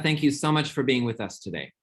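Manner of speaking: usual speaking voice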